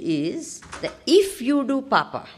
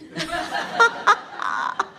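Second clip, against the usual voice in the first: quick airy giggle; high breathy laugh; 8 semitones higher, 4 dB louder than usual. Real, not acted. Laughs after the quote.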